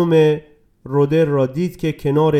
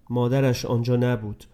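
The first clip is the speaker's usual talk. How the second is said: usual speaking voice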